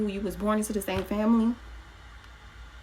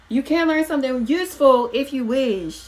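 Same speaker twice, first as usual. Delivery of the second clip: raised voice, much higher and louder; 6 semitones higher, 7 dB louder than usual. Real, not acted. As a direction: push it out loud and high. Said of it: "I just had to get loud".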